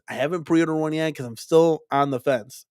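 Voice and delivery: ordinary talk, nothing marked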